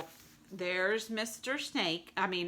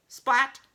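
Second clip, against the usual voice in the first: louder punchy splat; splat effect; 6 semitones higher, 9 dB louder than usual. Acted. Repeated impacts.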